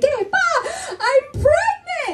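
high, drawn-out happy news; big stretched-out reveal, acted; excited announcement. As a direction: announce it to Dad, high and slow. Announcing pregnancy to her father.

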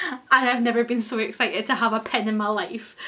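usual speaking voice

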